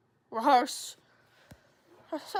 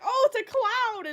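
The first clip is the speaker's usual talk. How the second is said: excited gasp; 11 semitones higher, 9 dB louder than usual. Retold or imitated. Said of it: "And she's like".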